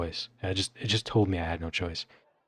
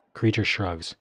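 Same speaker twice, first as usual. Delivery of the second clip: ordinary talk, nothing marked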